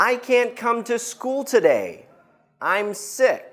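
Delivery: teacher showing students how to say it; model sentence. Shown as a demonstration. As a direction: say it clearly for the class to repeat. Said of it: "I'll say it one more time and then you say it".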